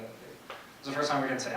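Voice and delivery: usual speaking voice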